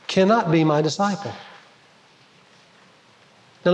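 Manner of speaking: ordinary talk, nothing marked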